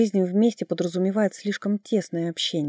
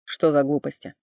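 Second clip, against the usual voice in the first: irritated; 2 semitones lower, 4 dB louder than usual. A narrator's reading. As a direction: snap back, annoyed. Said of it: "раздражённо переспросил Ричард". (раздражённо)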